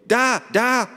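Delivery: very high, loud exclamation; sudden cry. A narrator's reading. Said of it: "rief sie plötzlich". (plötzlicher lauter, hoher Ausruf)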